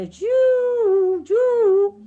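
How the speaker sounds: high loud wailing "ju, ju"; imitated siren howl; siren imitation. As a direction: wail it like a noon siren.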